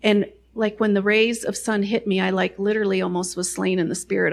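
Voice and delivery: ordinary talk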